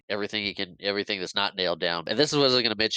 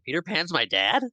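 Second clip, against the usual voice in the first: surprised; 7 semitones higher than usual. Retold or imitated. Daughter realizing in disbelief.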